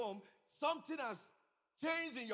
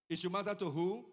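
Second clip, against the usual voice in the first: repeated louder, rising on "matter"; 8 dB louder than usual. Acted. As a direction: call the question out to the congregation. Preacher repeats question to congregation.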